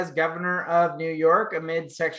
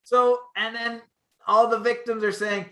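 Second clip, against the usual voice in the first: normal talking voice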